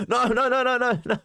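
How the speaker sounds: imitated high, loud flurry of protest; mock panicked denial. Retold colleague's alarmed denial.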